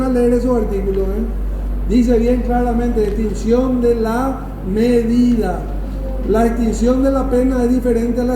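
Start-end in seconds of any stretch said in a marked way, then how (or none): 3.0-5.6 very slow, spaced-out words; deliberate citing. Real, not acted. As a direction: dictate it word by word to students. Teacher cites article's exact wording.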